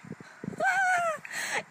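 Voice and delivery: high laugh. Real, not acted. Laughing at her own story.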